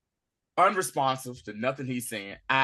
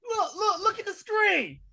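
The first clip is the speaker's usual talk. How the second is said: high, loud, ecstatic yelling; excited shouting to mom; 10 dB louder than usual. Retold or imitated. Son bursting in to show his mother, excited shouting. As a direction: shout it up to Ma like a thrilled kid. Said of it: "He's excited".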